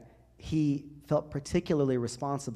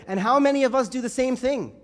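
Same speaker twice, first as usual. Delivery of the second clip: loud, high string of protests; a parishioner complaining to Abuna, exasperated; 11 semitones higher, 9 dB louder than usual. Acted. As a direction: pile up the whys in frustration. Voicing the congregation's complaints.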